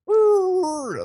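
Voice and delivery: high, drawn-out "woo"; loud, high-pitched whoosh of people scattering; imitated whoosh. Group scattering away from the crying man, high mock whoosh.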